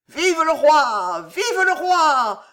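high, loud, slow cheer; crowd cheering. Acted. Courtiers hailing the arriving king: high, loud, slow cheer.

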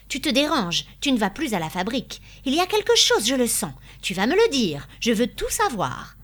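agitated wife demanding answers; insistent confrontation. A narrator's reading. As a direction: press him hard, peaking mid-speech. Wife demands the truth from husband.

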